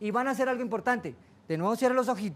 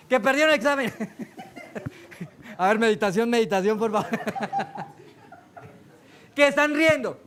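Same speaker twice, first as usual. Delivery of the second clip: louder, talking through laughter; laughing; 4 dB louder than usual. Real, not acted. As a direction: crack up mid-sentence. Laughing at the group. (entre carcajadas)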